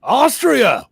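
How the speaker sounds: high, loud mock-surprised echo; film impression. Echoing the country in a film quote, loud hammed-up impression. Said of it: "on Dumb and Dumber".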